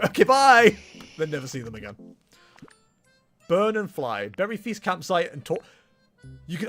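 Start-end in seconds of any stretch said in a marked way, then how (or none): 0.1-0.8 loud chirpy goodbye. Retold or imitated. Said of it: "I go".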